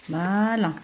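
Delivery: ordinary talk, nothing marked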